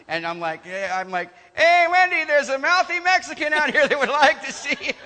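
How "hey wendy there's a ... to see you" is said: loud, high holler to someone across the building; calling out. Retold or imitated. Kitchen worker calls Wendy to the front, hollering. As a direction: yell it out for a co-worker.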